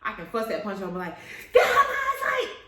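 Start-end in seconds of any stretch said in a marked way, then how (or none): 1.5-2.6 much higher, loud half-laughing yell; mock angry shout. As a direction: bark it at a naughty pet. Mock scolding a pet.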